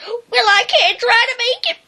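timid dog squeaking about his limp; squeaking Drover. Acted. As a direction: squeak it, half laughing. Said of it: "Drover squeaked".

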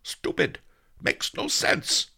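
high, strained, slow outburst in sleep; exasperated high-voiced dream grumble; angry muttering. A narrator's reading. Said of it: "he muttered angrily".

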